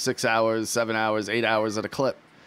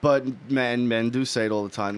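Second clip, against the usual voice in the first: ordinary talk, nothing marked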